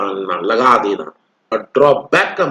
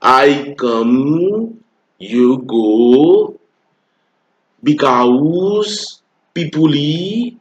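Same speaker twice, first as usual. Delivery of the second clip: mock rustic Indian English, stretched; much slower than usual. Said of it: "देखिए देहाती वाला English क्या है?".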